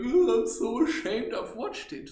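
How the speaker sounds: high, loud whiny mock shame; mock ashamed porn watcher. Mocking people who feel ashamed after porn, high whiny voice. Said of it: "they're like".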